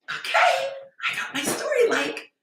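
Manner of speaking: high, squealy mock delight; mock giddy. Mocking women thrilled by a story view, high giddy. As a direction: gush like a lovestruck girl.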